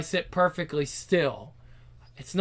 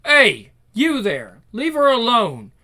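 loud, raised-pitch warning shout; stern intervention; 5 semitones higher, 6 dB louder, slower than usual. Acted. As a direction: call out sharply to stop the bullies. Bystander calls off bullies.

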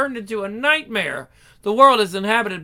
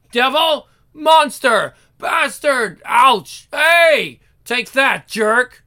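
angry mob shouting; 3 semitones higher, 6 dB louder, slower than usual. Acted. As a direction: hurl insults like a mob. Crowd attacks the accused.

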